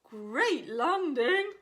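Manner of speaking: a kindly different creature voice; Beaver's voice. Acted. Beaver politely praising the landing, slow loud voice. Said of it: "agreed Beaver".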